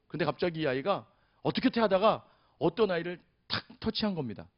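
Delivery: usual speaking voice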